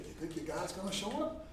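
quick, very soft asking; low, confidential retold question; hushed question. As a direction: ask it under your breath. Coach's private question before game.

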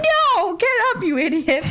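high, exasperated scolding; imitated outburst at a hapless buddy; mock exasperated yell. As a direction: scold a fool, laughing at the end. Retold scolding of gullible friend.

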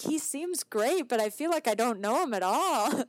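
bemused disbelief; baffled. Retold or imitated. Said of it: "I'm like".

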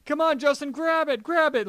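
high-pitched mock urgency; urgent urging. Retelling teammates urging a fallen friend, urgent and high. Voices Rangers urging on Justin.

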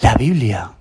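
mild mock shout of discovery; girl's delighted cry. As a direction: voice the child's sudden joy, lightly. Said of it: "el grito que dio".

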